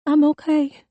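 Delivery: put-on high, tremulous girlish reassurance; quivering voice. A narrator's reading. Girl repeats it to her parents, voice quivering. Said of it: "her voice quivering".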